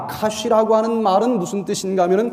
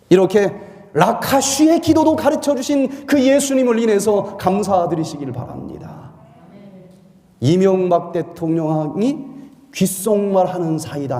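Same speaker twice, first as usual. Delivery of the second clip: normal talking voice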